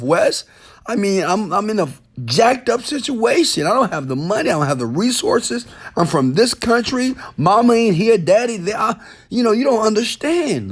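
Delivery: mock the excuses of a struggling fan; imitating a whiny complainer. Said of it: "I hear this from people saying".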